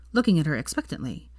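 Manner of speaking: plain reading voice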